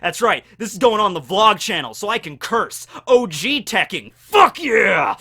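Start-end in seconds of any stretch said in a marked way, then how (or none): 3.1-5.2 hyped, loud yell at the mic; excited shout. Real, not acted. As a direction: shout it out like a pumped-up hype man. Hyped vlog intro yell.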